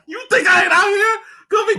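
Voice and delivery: high, shouting through laughter; laughing rant. Real, not acted. Ranting at Leroy about the game, laughing. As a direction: rant while cracking up.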